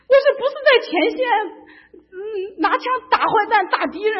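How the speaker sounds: high, loud half-crying plea, rushed; mock wail. Telling her friend on the phone she can't hold on, mock crying wail. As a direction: wail it in comic distress.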